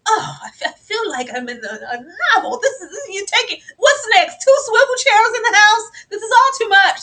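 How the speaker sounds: high, loud laughing dowager; a hammed-up fussy old dowager, overwhelmed; mock Violet. Violet overwhelmed by swivel chairs, laughing hammed-up dowager. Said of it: "Violet's like".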